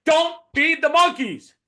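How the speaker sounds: high, loud, slow stern command; loud drawn-out warning shout; emphatic warning. Shown as a demonstration. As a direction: bark the warning so nobody misses it. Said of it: "She probably should have said".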